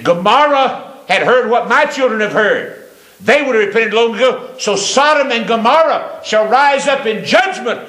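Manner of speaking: high-pitched, ringing pulpit warning; impassioned preaching. Acted. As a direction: raise the pitch and proclaim judgment.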